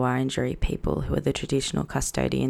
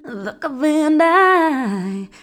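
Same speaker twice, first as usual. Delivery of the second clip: higher, slower and louder still; even angrier repeat; 11 semitones higher, 6 dB louder, much slower than usual. Real, not acted. Survivor repeating her curse at senders, higher and louder. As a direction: stretch "die" out in full rage.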